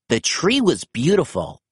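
ordinary delivery

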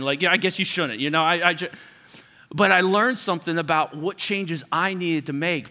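ordinary delivery

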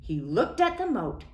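ordinary delivery

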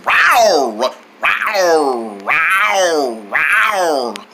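low, drawn-out falling rawrs; tiger roars. Acted. Acting like a tiger, low roars. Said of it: "I act like one".

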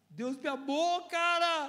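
high, loud joyful outburst; rising exultation. Real, not acted. Preacher bursting out over being loved by God, rising exultation. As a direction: build from the words into a high cry.

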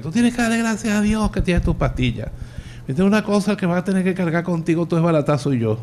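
high-pitched exasperated scolding; mock scolding. Son scolding his elderly mother over pills, high exasperated scold. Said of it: "yo empezaba a pelear con ella".

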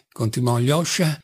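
ordinary delivery, nothing marked

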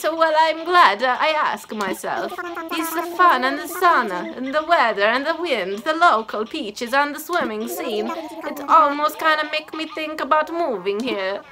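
badly attempted Italian accent; [name]. Camper greeting the islander in a mock Italian accent. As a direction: have a clumsy go at Italian. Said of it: "I'm not good with Italian accents".

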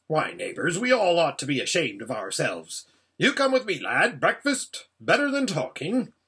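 animated, bossy dwarf voice; brisk, chiding dwarf. Acted. Dwarf shaming neighbours and taking Shasta to breakfast, brisk. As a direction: scold the company, then take charge.

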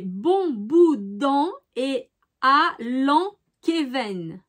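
slow, halting word-by-word reading; slow and deliberate, feeling out each syllable; careful foreign-phrase reading. Real, not acted. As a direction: sound out an unfamiliar regional phrase. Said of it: "avec mon accent, c'est, c'est pas".

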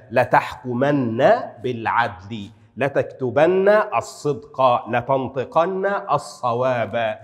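slow, with "-anna" held in لتحكمن, لتكتبن, لتنطقن; measured examples, لتحكمن ending in a long "-nna"; doubled emphatic nun. Shown as a demonstration. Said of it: "عندما تكون مشددة أقول".

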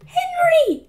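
put-on very high little-boy squeak; squeaked cry. A narrator's reading. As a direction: squeak your brother's name. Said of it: "squeaked Peter".

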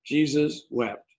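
Jesus wept said slowly, each word weighed; slow solemn scripture read. Shown as a demonstration. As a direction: let "Jesus wept" land slowly and gravely. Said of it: "Two words".

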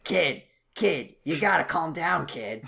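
loud wisecracking sidekick impression; Danny DeVito. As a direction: do a punchy tough little guy telling off a kid. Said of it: "Danny DeVito is how I would describe it".

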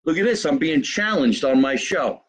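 ordinary talk

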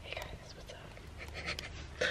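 whispered vlog greeting; whispering. Real, not acted. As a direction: breathe the hello, no voice. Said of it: "vlog intro whisper".

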